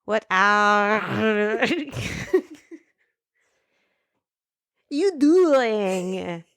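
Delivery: drawn-out Scottish Shrek attempt, breaking into laughter; Scottish accent. Shown as a demonstration. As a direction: attempt rolled R's in a halting Scottish accent. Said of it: "where he does a Scottish accent".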